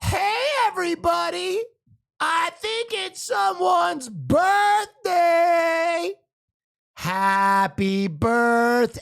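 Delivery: high, slow drawn-out announcement. Retold or imitated. Friend announcing a birthday to the crowd, high slow call. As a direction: call it out slowly to the whole room. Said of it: "he raises his voice and goes".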